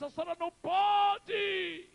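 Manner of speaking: very high, drawn-out wailing shout; anguished scream. Retold or imitated. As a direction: scream it at a mother's death, stretching the last word. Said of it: "eu gritei".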